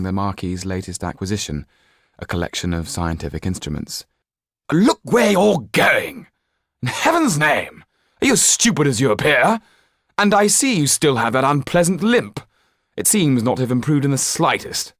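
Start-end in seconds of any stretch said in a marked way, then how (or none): 4.7-14.9 higher, much louder, exasperated scolding; irritable outburst. Acted. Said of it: "said the Marquis irritably".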